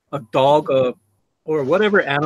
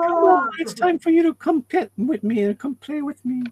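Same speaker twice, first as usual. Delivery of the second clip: put-on high baby-talk voice for the dog; high-pitched talk to a pet; 12 semitones higher than usual. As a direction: coax the dog in a high, sweet voice. Voicing words for the dog.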